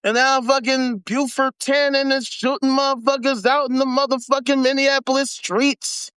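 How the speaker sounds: ordinary talk, nothing marked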